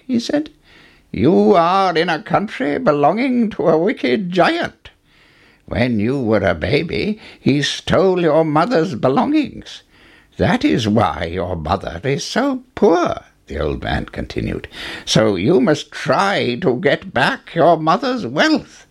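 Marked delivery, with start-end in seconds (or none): 1.1-13.3 lightly voiced old man, higher pitch; a touch of an old man's urgent warning. Old man warns Jack. 15.0-18.9 put-on old man urging, slow and higher; lightly voiced old man giving counsel. Old man urges Jack on.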